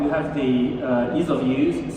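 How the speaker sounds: ordinary talk, nothing marked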